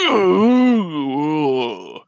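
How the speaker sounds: long, loud "ooh" sliding down from high; drawn-out, falling slow-motion cry; slow-motion reeling groan. Retold or imitated. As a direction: imitate a hit in slow motion. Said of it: "He reels back both times because he's slowed. It's like".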